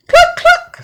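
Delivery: high, loud clucking call; hen clucking call. Acted. Hen calls her chicks to the grain, high clucking. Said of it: "said the little red hen".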